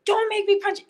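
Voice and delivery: high, loud fired-back threat; mock yell. Answering the man who threatened her, a high loud shout. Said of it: "And I was like".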